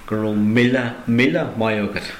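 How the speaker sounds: míle, míle both weighted, falling finish; doubled míle stressed. Shown as a demonstration. Teacher doubling míle to really add thanks. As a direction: hit each míle to pile on thanks. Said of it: "to really add on the thanks".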